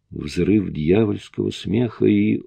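ordinary delivery, nothing marked

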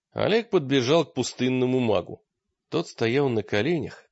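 ordinary delivery, nothing marked

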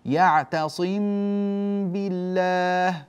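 slow, a long hummed meem before بالله; nasal hold on the meem. Shown as a demonstration. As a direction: hold the ghunna in يعتصم بالله. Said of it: "لا بد من الغنة".

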